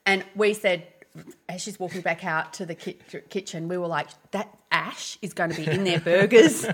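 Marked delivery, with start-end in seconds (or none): 4.3-6.7 louder, half-laughing mock horror in a French cafe; gleefully horrified, all in fun; retelling the joke with dad in mock horror, louder. Mock dread about waitress's revenge.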